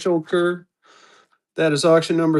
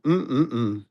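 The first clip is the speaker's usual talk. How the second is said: normal talking voice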